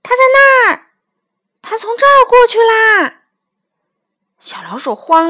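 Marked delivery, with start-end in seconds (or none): 0.0-3.2 high, very loud excited yells; loud, high-pitched calling out; children shouting. A narrator's reading. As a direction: shout out as if chasing something. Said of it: "孩子们叫着".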